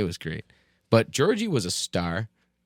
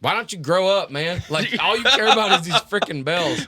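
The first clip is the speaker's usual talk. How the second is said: louder, higher cheeky retort; mock teen sass; 8 semitones higher, 6 dB louder than usual. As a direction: snap back like a bratty kid. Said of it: "With the accent and everything".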